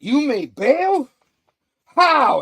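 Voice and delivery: loud, high and drawn-out questions; outraged disbelief. Retold or imitated. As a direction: cry it out in shocked indignation. Hero stunned by villain's release.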